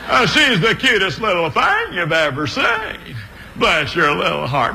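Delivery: high, jittery Mayberry deputy voice; nervous, fussy small-town deputy talking up his girl; Don Knotts impression. Comedian doing Don Knotts for a church crowd, high and jittery. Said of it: "there's the voice of old Don Knotts".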